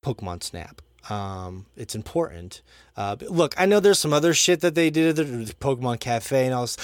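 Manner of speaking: ordinary talk, nothing marked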